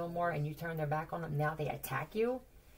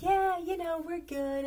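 high, bright-sounding fake cheer, much louder; fake happy; 11 semitones higher, 5 dB louder, slower than usual. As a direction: put on a forced carefree front. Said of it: "someone's acting like they're happy".

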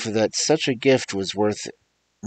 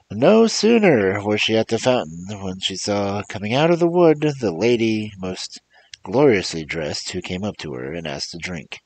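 plain reading voice

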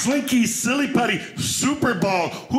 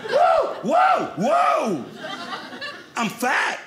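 startled by mirror; 10 semitones higher, much slower than usual. Acted. Startled by his own reflection in the mirror, high yelp. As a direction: jump back at your own reflection.

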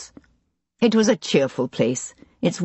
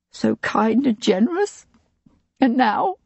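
voice cracking; 6 semitones higher than usual. A narrator's reading. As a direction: voice breaking on the last words. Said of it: "Her voice cracked".

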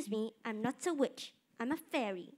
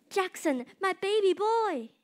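loud, high overjoyed call; mother overjoyed to see son; joyful reunion cry; 7 semitones higher, 11 dB louder than usual. Acted. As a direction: call out with relief and joy.

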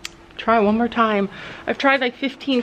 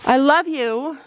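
ordinary talk

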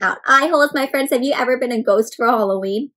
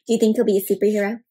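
ordinary delivery, nothing marked

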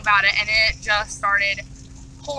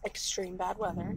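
Extreme bad weather. said much softer and lower, almost whispered; whispered warning; 5 semitones lower, 17 dB quieter than usual. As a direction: drop to a spooky hush on Extreme bad weather. Repeating quieter still.

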